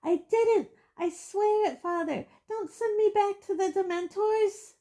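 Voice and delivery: high panicked shriek. Acted. Boy in chains shrieking to his father, high panic. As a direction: shriek your innocence. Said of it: "shrieked the boy in chains below".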